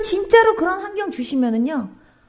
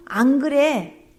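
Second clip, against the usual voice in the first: slow firm denial; 3 semitones lower, much slower than usual. Retold or imitated. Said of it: "그랬더니 하나님께서".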